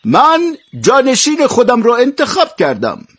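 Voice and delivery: emperor's high-pitched, projected announcement; loud proclamation. A narrator's reading. Emperor announces his successor to the gathered children, loud and high. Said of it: "با صدای بلند گفت".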